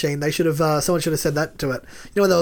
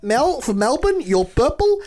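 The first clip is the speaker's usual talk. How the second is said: higher-pitched mock Greek accent; [name]; 6 semitones higher, slower than usual. Calling the pillow flip as a housemate, Greek accent. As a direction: play it up in a put-on Greek accent. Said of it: "accent was offensive".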